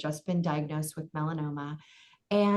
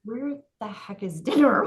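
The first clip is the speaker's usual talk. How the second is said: snapping at mom; 4 semitones higher, 5 dB louder than usual. Retold or imitated. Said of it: "gave her a look that only a mom would know".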